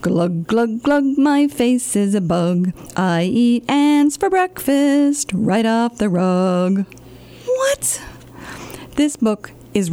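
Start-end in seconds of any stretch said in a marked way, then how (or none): 0.0-6.8 slow, drawn-out silly rhyme; silly sing-song. Acted. Librarian reads the silly rhyme to kids, slow. 7.4-8.0 much higher whispered what; whispered shock. Acted. Librarian shocked by the rhyme, high whispered what.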